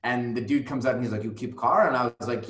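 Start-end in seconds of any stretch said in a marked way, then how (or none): 1.2-1.9 higher, louder imitation of an Eastern European accent. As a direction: mimic an Eastern European man's broken English. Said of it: "a group of seemingly Eastern European men".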